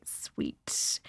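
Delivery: ordinary talk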